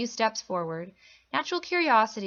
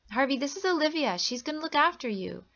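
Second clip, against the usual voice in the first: higher, lilting sing-song; 7 semitones higher than usual. A narrator's reading. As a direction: talk to him like to a small child. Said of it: "the same sing-song voice".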